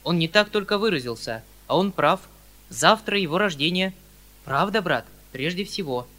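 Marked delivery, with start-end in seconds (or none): none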